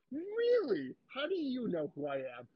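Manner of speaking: high drawn-out playful question; hammed-up flirty curiosity; coy mock surprise. Acted coy reply to bartender.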